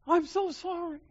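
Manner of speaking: high-pitched acted tearful apology; high mock weeping; mock sobbing. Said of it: "And she's sobbing".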